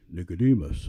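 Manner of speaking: Nicodemus said low and solemn, the whole name; full formal name. Shown as a demonstration. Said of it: "and you would say".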